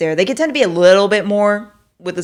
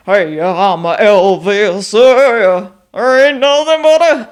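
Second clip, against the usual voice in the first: loud, drawn-out [name] impression, pitched up; exaggerated [name]; 3 semitones higher, 10 dB louder, slower than usual. Shown as a demonstration. Vlogger showing how drastic she expected his voice, exaggerated [name]. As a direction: belt it out as a way over-the-top [name]. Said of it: "like, way more drastic".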